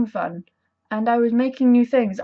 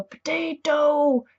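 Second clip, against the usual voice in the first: slow, drawn-out Irish "Potato", pitched higher; Irish accent; 5 semitones higher, much slower than usual. Shown as a demonstration. Explaining the blood potato game to viewers, in a slow Irish accent. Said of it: "in your Irish accent".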